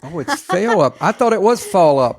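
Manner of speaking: "fail" 깨닫고 높고 크게 놀란 목소리; high, loud burst of surprise at "fail up"; startled realization. Real, not acted. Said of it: "I thought that was your accent".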